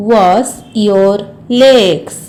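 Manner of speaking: slow, word-by-word model sentence; slow repeat. Shown as a demonstration. Teacher repeats the washing instruction for learners, slowly. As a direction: space out each word for learners.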